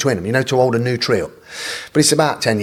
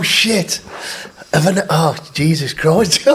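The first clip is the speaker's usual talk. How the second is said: usual speaking voice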